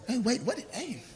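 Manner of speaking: ordinary talk, nothing marked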